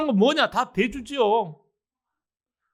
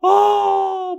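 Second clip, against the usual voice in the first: loud, high, long-held wail; a drawn-out "aaah" imitating anguished praying; imitated prayer wail; 10 semitones higher, 7 dB louder than usual. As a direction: cry out in prayer, acted. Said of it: "막 하고 기도를 합니다".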